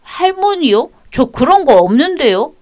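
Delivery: high, sulky schoolboy answering back; child's defiant voice. Acted. Grandson snapping at his grandmother, high child voice. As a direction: talk back like a stubborn kid.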